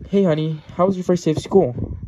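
high, loud motherly greeting; chirpy mom. Acted. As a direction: greet your kid home from school. Mom greets son home from school.

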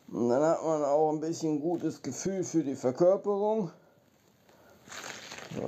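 ordinary talk

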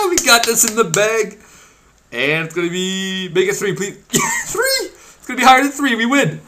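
high, loud, laughing hype; real giddy triumph; giddy excitement. Real, not acted. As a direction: cheer on the roll, giddy. Gamble going his way.

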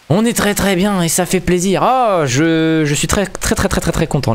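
high, gushing delight; overjoyed high voice. Real, not acted. Gamer gushing to his viewers, high and delighted. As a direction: beam with joy at the audience.

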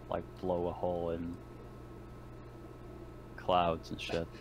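ordinary talk, nothing marked

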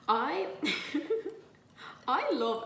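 breaking into laughter mid-sentence; start, laugh, then carry on. Real, not acted. Laughing at own accent.

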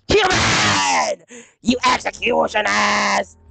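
harsh, drawn-out cartoon shout; hammed-up roar of a game character; mock character yell. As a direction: belt it out as an over-the-top villain. Bursts into yelled character line.

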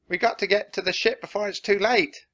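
high, loud pirate warning; alarmed shout. A narrator's reading. Pinky warns the crew about the bird, shouting. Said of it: "shouted Pinky".

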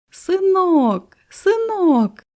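high, delighted call to her son; joyful cry. A narrator's reading. Mother greeting her new son, high and joyful. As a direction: cry out with joy. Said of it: "закричала мати".

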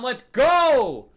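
high, loud, stretched GO; pumped-up victory shout; hyped cheer. Real, not acted. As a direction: yell it in triumph. Found the next realm.

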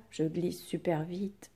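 whispered, lifeless "Je glisse super vite"; flat whisper. Shown as a demonstration. Teacher showing pupils the wrong way, whispered. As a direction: drop it to a toneless whisper. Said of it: "on dit pas".

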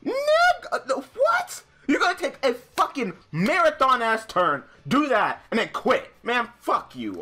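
high, loud incredulous rant; outraged shouting. Real, not acted. Gamer cursing the opponent who quit, outraged high shout. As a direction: explode in real disbelief at the opponent. Opponent quits after marathon turn.

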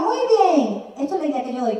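ordinary talk

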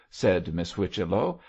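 usual speaking voice

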